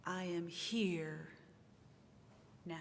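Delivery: slow "I am here" leaning on "here", then "now" after a pause; stress on here. Shown as a demonstration. Minister rereading to congregation, slow on "here". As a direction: linger on "here". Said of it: "I will read it two times through".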